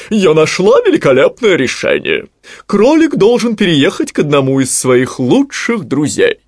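loud, high, triumphant owl voice; exclaiming owl. A narrator's reading. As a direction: proclaim the great discovery. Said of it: "вдруг воскликнула она". (громко и высоко, торжествующий голос совы)

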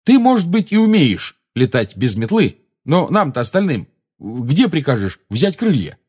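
barely restrained. A narrator's reading. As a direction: challenge them with temper straining. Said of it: "едва сдерживаясь".

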